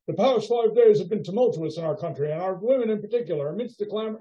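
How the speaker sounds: lightly voiced TV news anchor; newscaster voice. Shown as a demonstration. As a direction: read it like a stock news anchor. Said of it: "it sounds to me like that newscaster voice".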